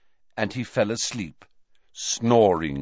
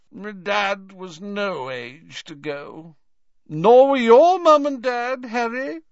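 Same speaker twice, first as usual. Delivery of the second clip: put-on tearful drunk; 13 semitones higher, 9 dB louder than usual. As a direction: blubber it out, drunk and weeping. Drunk Hagrid weeping over Potters.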